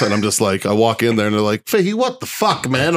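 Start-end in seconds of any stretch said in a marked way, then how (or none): 1.7-2.9 much higher, alarmed disbelief; shocked medic. Retold or imitated. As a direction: react like a medic seeing a bloody mess. Medics react to his wounds.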